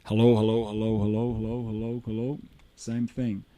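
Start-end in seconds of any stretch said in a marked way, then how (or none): 0.0-1.3 full, direct into the mic; front of the mic. Shown as a demonstration. Teacher tests the dynamic mic, full from the front. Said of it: "You can hear the rejection of this guy here". 1.3-2.4 muffled and rejected; back of the mic. Shown as a demonstration. Teacher tests the dynamic mic, muffled from behind. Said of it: "You can hear the rejection of this guy here".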